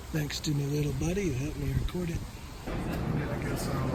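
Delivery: usual speaking voice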